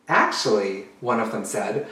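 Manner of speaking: put-on lively storytime narration for kids; storybook reading. Drag queen reads a picture book to children, animated. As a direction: read it out like storytime for little ones.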